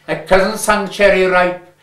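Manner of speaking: ordinary delivery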